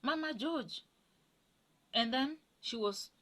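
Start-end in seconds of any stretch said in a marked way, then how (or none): 0.0-0.8 high, sweet-talking caller voice; higher voice of a mother faking friendliness in Swahili; mother's put-on voice. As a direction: play the mother-in-law putting on a sugary phone voice. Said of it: "talking to me with a different tone of voice".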